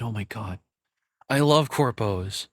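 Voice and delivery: ordinary talk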